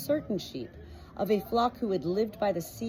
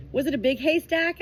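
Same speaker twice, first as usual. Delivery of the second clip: put-on higher, louder young lamb voice; young sheep asking; 7 semitones higher, 5 dB louder than usual. Young Heather begging to know more, higher and eager. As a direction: ask eagerly, not wanting it to end. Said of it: "didn't like it when stories ended".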